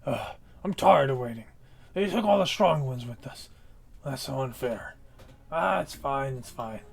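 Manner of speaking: loud, gruff orc grunt; brutish orc voice. Acted. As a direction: bark it like a bored brute.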